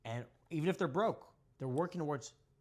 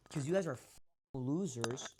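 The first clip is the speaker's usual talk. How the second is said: ordinary talk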